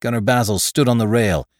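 plain reading voice

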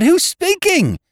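high, loud urgent question; urgent calling. Retold or imitated. Housekeeper calling into the telephone, high and loud. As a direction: call down the line anxiously. Miss Ryder calling into phone.

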